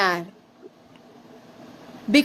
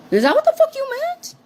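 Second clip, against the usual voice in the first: high, fast mocking squeak; squeaky mock voice; 14 semitones higher, faster than usual. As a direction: mimic her in a silly high pitch. Mocking the other's meaning.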